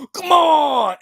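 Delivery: high, loud shouted "Come on!"; mock yell. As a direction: yell "Come on!" like a fighter's last-second hype. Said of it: "where you yell".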